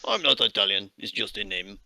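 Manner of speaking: halting, polite foreign worker asking the way; Russian accent. Acted. Miner NPC continues.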